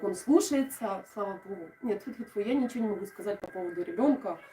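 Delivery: ordinary talk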